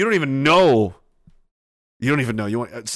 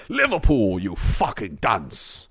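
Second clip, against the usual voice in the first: mock British accent; 6 semitones lower, 3 dB quieter, slower than usual. Imitating British correction.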